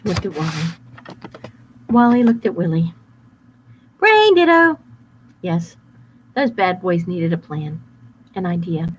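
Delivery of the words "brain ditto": high loud goofy voice; dim wolf. Acted. Bad boy agreeing with partner, high goofy. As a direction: blurt it out dopey. Wolves' catchphrase.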